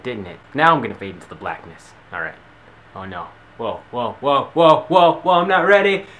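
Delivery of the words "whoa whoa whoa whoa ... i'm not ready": building panicked loud whoas; rising alarm as it fades. Acted.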